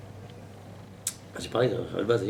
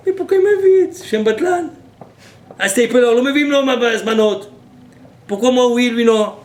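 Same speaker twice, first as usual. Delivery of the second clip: high, loud cry of the weeping rabbi; retold rabbi's loud, high-pitched lament; anguished lament; 12 semitones higher, 12 dB louder than usual. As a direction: wail it out as the crying rabbi who calls himself worthless.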